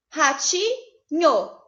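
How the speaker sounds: ratinho said very slowly with a different break; syllable-split ratinho. Shown as a demonstration. Teacher showing the second split of ratinho, very slowly. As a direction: stretch out ratinho and pause in a new place.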